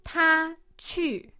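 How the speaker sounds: high level 他, then 去 plunging to the bottom; fourth-tone demo. Shown as a demonstration. Teacher demonstrating the tone for learners, high 他 then falling 去. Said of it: "fourth tone starts at the top".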